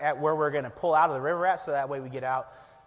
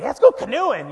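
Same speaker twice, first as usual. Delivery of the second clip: eager call; 8 semitones higher, 8 dB louder than usual. Retold or imitated. Excited suggestion in retold story.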